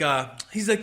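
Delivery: normal talking voice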